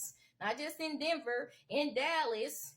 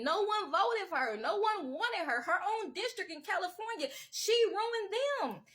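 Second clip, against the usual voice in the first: heated; 8 semitones higher, 4 dB louder than usual. Real, not acted. Telling voters nobody wanted her, rising anger. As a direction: voice climbing high and loud in anger.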